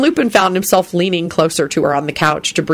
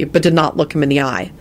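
ordinary delivery

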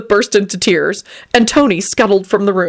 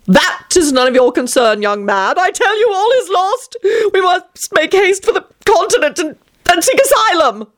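rapturous; 12 semitones higher than usual. Acted. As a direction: gush it out in raptures. Vampire Tony's voice.

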